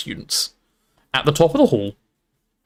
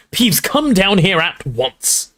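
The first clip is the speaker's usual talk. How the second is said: slow, higher, stern command; angry yell; 7 semitones higher, much slower than usual. A narrator's reading. Professor ordering the poltergeist down, angry yell. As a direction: scold with each word drawn out. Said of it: "yelled an angry voice".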